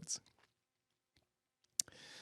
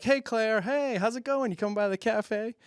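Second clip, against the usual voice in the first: chirpy greeting; 7 semitones higher than usual. Retold or imitated. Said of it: "they're like".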